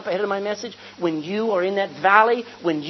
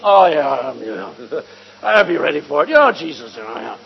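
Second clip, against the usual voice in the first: lower, offhand mock of a casual shrug; mock nonchalance; 7 semitones lower, 6 dB louder than usual. Preacher mocks someone shrugging off the storm, lower and offhand. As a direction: play it cool and lightly mocking. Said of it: "don't think".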